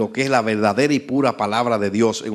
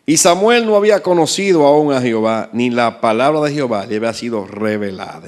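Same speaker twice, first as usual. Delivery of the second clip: scripture reading; 5 dB louder than usual. Real, not acted. Preacher reads verse seven.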